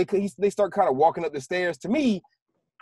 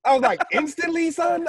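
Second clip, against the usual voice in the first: high-pitched mock disbelief; incredulous; 10 semitones higher, slower than usual. Viewer reacting to the hero forgetting instantly, incredulous. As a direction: question it high, as if appalled. Retold disbelief at character's act.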